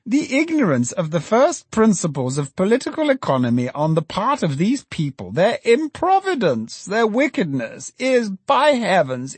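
put-on high, fussy, scandalised old economist; indignant Filer. A narrator's reading. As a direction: sputter with outrage, pitched high. Filer voiced in narration.

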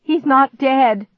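high, loud cry of alarm; alarmed, drawn-out shout. A narrator's reading. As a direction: cry it out slowly in panic. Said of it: "I cried".

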